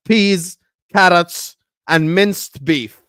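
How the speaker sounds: emphatic correction. Real, not acted. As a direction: hammer out each word separately. Emphatic list correcting the chatter.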